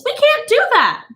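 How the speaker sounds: high, fretful coaxing voice; high nervous cajoling; nervous lady. A narrator's reading. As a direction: soothe the room while scared underneath. Mrs Bowles voiced.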